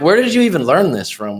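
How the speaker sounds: retold baffled questioning; incredulous. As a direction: demand the source in disbelief. Retold challenge in argument.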